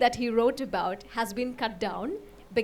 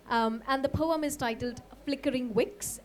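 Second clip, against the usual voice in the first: normal talking voice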